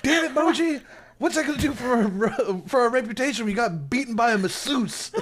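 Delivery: high, loud rant at an underling; mock outraged scolding. Captain scolding Moji for the beating, mock outraged. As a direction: berate him in hammed-up disbelief.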